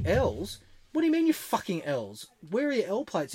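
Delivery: high-pitched mock disbelief; retold cop demanding an explanation; incredulous grilling. Copper grills a learner about missing plates, mock incredulous.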